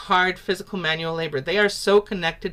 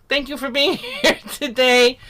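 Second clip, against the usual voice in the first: higher, laughing thank-you; 6 semitones higher, 4 dB louder than usual. Real, not acted. Teacher laughing while thanking a student. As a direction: laugh through the gratitude.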